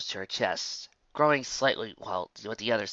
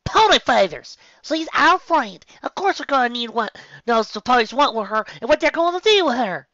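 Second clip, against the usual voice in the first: loud, high frustrated shout; a filly blowing up in frustration; frustrated outburst; 13 semitones higher, 10 dB louder than usual. Acted. Filly shouting at her friends about their captured friend, furious. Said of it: "shook in frustration".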